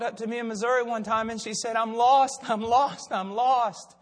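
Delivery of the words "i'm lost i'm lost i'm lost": louder, insistent repeated plea; retold desperation. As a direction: imitate a distressed seeker, mildly. Voices desperate woman seeking help.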